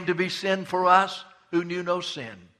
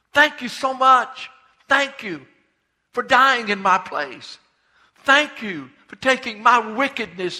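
fervent prayer; 7 semitones higher, 8 dB louder than usual. Pretend. On his knees, the preacher thanks Jesus in fervent prayer. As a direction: pour out thanks on your knees. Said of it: "I just want to say".

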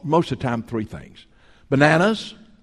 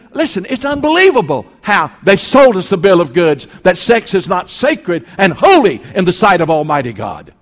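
louder preaching, driving the point home; forceful preaching; 4 semitones higher, 8 dB louder than usual. Acted. Preacher condemning television to his congregation, loud and forceful. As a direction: thunder it at the pews. Preacher rails against TV sex.

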